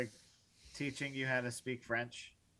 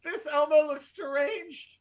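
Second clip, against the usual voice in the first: high, slow, laughing through it; 6 dB louder than usual. Real, not acted. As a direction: giggle out the words. Laughing at deranged Elmo.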